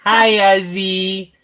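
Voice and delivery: high, drawn-out pet voice. Real, not acted. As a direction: coo at the cat with stretched vowels. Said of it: "in a baby voice".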